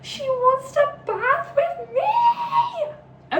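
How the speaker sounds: high, very slow squealing voice; giddy monster voice. Retold or imitated. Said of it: "And he kind of went".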